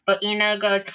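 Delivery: usual speaking voice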